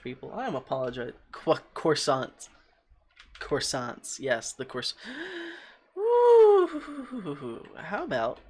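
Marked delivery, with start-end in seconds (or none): 5.0-5.6 high startled gasp; sudden high gasp of alarm; sharp gasp. Real, not acted. Startled by enemy. 5.9-7.6 high loud startled woo; loud high-pitched cry; high yelp. Real, not acted. Startled by enemy.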